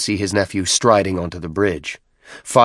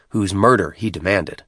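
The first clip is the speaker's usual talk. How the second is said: ordinary delivery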